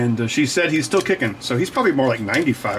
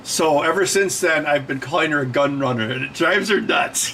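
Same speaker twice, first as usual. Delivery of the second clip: ordinary delivery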